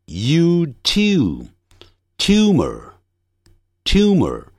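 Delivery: tumor built up as t, yoo, "tyoo-mer"; very slow British "tumor" with y glide; British pronunciation. Shown as a demonstration. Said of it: "英式发音". (英式发音，慢速拼读tumor，带一音)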